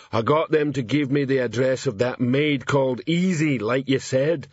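plodding troll voice, little emotion; thick-voiced troll constable; stoical troll. A narrator's reading. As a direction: report dutifully as a stolid troll. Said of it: "said Detritus stoically".

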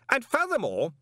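pompous indignant rant; righteous indignation. Acted. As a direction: bluster on regardless. Stoley ranting oblivious.

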